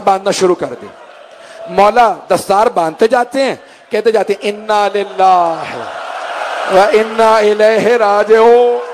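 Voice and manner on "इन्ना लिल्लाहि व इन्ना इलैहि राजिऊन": grieving recitation. Retold or imitated. Father reciting while tying his son's turban, grieving chant. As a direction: draw the verse out into a wailing chant.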